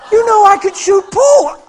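loud, high, triumphant cry; mock excited exclamation; acted jubilant shout. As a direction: shout it like newly healed. Acting the man's jubilant claim.

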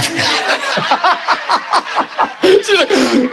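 a long high burst of laughter. Real, not acted. Preacher laughing at his own joke to the church, high laugh.

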